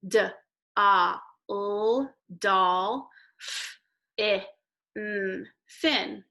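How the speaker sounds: each sound said apart then doll, fin; teacher blending syllables doll and fin; sounding out dolphin. Shown as a demonstration. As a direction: segment D, a, l and f, i, n slowly. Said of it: "Let's do each syllable".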